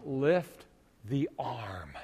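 very slow, breathless whisper; strained whisper. Retold or imitated. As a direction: coax the arm with barely a breath. Straining body, exhausted.

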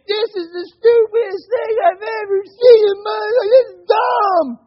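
put-on high, loud cry of a furious child; frustrated kid yelling. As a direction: yell it like a fed-up little boy. Frustrated son yelling at jump rope.

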